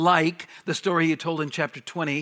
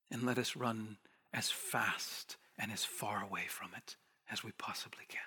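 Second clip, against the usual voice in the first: intense whisper, faster at the end; hushed plea; 6 semitones lower, 15 dB quieter than usual. Real, not acted. Pastor urges the flock to flee, whispering. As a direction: drop to a whisper to urge them.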